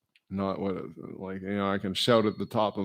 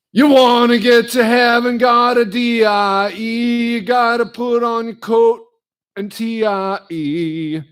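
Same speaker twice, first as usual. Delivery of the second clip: earnest but bad country holler; 13 semitones higher, 10 dB louder than usual. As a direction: holler it like an outlaw country singer. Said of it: "here's what [name] said".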